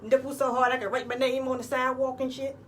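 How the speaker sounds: ordinary talk, nothing marked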